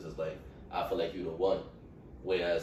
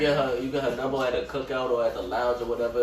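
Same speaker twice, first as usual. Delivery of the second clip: raised, higher voice, pressing on; insisting over interruption; 6 semitones higher, 7 dB louder, faster than usual. Real, not acted. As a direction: talk loudly over the hecklers. Restarts point after being interrupted.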